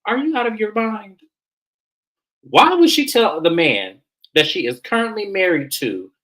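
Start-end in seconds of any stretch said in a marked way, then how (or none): 0.1-1.2 incredulous. Retold or imitated. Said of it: "I'm looking at him like".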